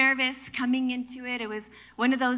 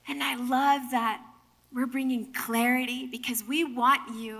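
ordinary talk, nothing marked

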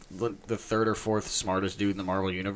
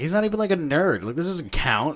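indignant; 7 semitones higher, 4 dB louder than usual. Retold or imitated. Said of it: "And I'm like".

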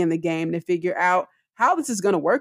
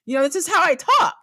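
high-pitched, animated protest, not yelling; voice going up; 9 semitones higher than usual. Retold or imitated. Wife defending herself to ex-husband, high animated protest. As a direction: protest in a raised pitch without shouting. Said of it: "My voice is just going up".

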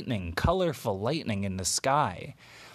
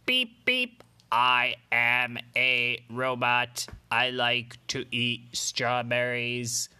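robot voice; slower than usual. Acted. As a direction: speak like a toy robot, slowly. Robot character speaks in story.

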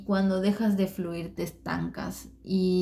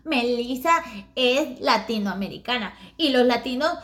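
high, louder squeaky scolding; cartoon scolding; 6 semitones higher, 6 dB louder than usual. Little Japanese friend scolding Eduardo, high and loud, ending in laughter. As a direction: scold him like a tiny cartoon girl.

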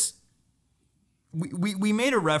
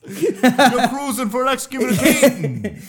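high, loud hammed-up threat; hard Jersey pastor; 9 semitones higher, 9 dB louder than usual. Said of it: "you need to come out New Jersey hard".

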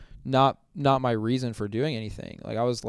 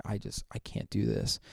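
breathy, hushed and drained, barely voiced; defeated whisper; 3 semitones lower, 8 dB quieter than usual. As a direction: whisper it like you've given up. Retelling despair alone after youth group.

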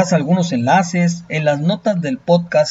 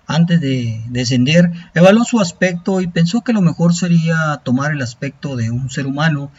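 epic fantasy narrator; 3 semitones higher than usual. A narrator's reading. Narrates an Aztec legend.

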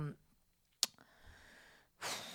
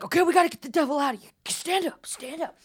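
fast, high, loud command; urgent exorcist; 8 semitones higher, 6 dB louder than usual. Retold or imitated. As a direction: rush them up to drive the devil out. Psychic exorcising devil, urgent.

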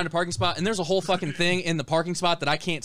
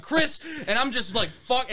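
high mock yell without the volume; exasperated cry, held back in level; retold screaming; 7 semitones higher than usual. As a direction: imitate your own irate outburst, pitched up. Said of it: "and I'm fucking screaming".

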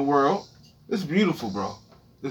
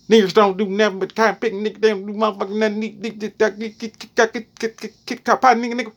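Louisiana slave master; 2 semitones higher than usual. Retold or imitated. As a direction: imitate a Louisiana master, fast gibberish. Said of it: "That's how slave masters sound in Louisiana".

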